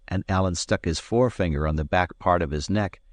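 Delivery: ordinary delivery, nothing marked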